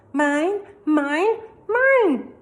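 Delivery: rising to a high loud wail; toddler tantrum. A narrator's reading. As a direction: grab it and yell like a toddler. Toddler Fudge clutches book.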